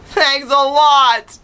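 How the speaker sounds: high, loud, slow sarcastic shout; sarcastic yell. A narrator's reading. Charlie yelling after his departing brother, sarcastic and loud. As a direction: drag out the thanks, yelling. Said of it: "I yelled".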